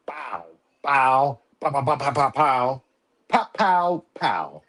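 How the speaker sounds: spoken pow-pow gunfire; celebratory mouth-made gun sounds; imitated gunshots. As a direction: fire off little bursts of pows.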